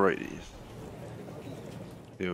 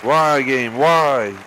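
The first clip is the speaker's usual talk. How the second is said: loud, high frustrated yell; angry outburst; 3 semitones higher, 4 dB louder, much slower than usual. Real, not acted. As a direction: shout at the game in disbelief. Shot goes wrong, player yells.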